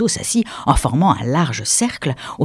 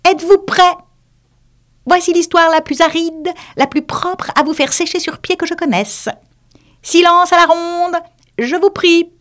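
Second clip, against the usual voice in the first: high, pompous little mouse voice; self-important mouse; 3 dB louder than usual. Acted. The mouse calling the wet animals to order, high and pompous. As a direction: puff up like a tiny lecturer.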